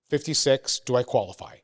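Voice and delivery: usual speaking voice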